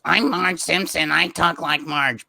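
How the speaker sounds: the way everyone gets Marge wrong; wrong Marge impression. Shown as a demonstration. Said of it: "everyone goes".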